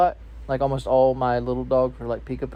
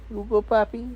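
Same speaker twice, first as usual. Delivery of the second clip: talking to a dog; 9 semitones higher than usual. Real, not acted. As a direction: coo it way up high.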